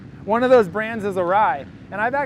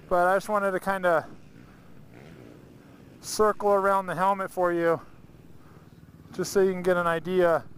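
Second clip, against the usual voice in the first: talking inside a helmet; muffled in helmet. Real, not acted. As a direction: speak through a helmet mic, muffled. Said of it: "we actually put a mic in the helmet".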